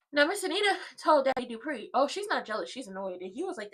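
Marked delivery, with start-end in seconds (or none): none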